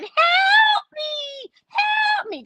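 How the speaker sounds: very high squeaky shout of help me; The Fly's tiny cry. Retold or imitated. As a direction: squeal it like the fly-headed man in the film. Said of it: "Do you remember The Fly?".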